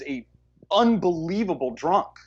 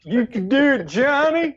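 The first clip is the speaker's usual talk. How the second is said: mock cheer; 7 semitones higher, 4 dB louder than usual. Movie line echo, mock cheer.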